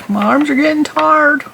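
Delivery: put-on high, whining childish voice; whiny complaint. Knitter mock-whines about her tired arms, high and childish. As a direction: whine it like a tired little kid.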